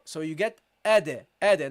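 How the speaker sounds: ade with the d bounced off; bounced dal. Shown as a demonstration. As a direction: give the d in ade a little echo bounce. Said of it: "Now notice how I gave this a bounce".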